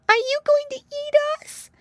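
very high, alarmed little voice; crying piglet. A narrator's reading. Pinky questions the hungry wolf, crying out. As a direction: squeal the question as a scared piglet. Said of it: "cried Pinky".

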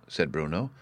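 plain reading voice

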